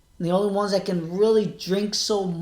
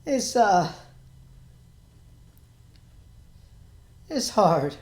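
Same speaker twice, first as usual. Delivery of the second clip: holding back tears; 4 semitones higher than usual. Real, not acted. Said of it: "I'm trying not to cry because".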